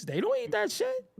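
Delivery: high, sneering put-on voice; high mocking scoff; mock dismissive voice. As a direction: mimic someone scoffing at the food.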